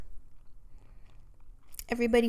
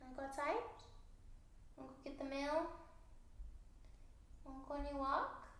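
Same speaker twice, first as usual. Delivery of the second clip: pet talk; 4 semitones higher, 17 dB quieter than usual. Real, not acted. Talking to her dog Nala.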